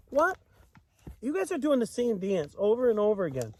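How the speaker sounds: high squeaky "What?" then quick teasing complaint; mock surprise. Real, not acted. Parent teasing kids at bedtime, mock surprise. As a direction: pretend to be shocked at the listeners.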